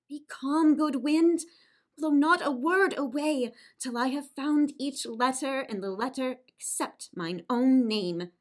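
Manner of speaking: urgent cry to the wind; calling to the wind. Acted. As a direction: raise the voice to hold back the wind. Julia calls out to the wind.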